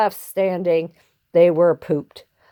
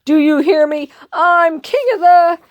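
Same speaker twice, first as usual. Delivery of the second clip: louder, rising tyrant's shout; snarling boast; 8 semitones higher, 5 dB louder than usual. Acted. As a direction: crow over them, higher and louder. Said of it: "he snarled".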